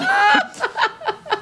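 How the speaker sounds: a burst of high laughter. Real, not acted. Guest laughing at her own story, high laugh.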